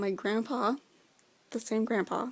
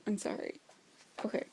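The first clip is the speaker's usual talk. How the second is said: whisper; 6 dB quieter, faster than usual. Real, not acted. Embarrassed apology after quote.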